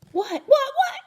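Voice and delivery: very high, loud hamster squeaks; frantic squeak. A narrator's reading. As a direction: squeak in a hurry to see. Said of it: "I squeaked".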